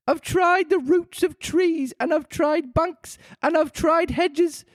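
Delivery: slow, high, put-upon bird; a complaining pigeon, drawn out. A narrator's reading. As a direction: pigeon complains about serpents. Voices the pigeon.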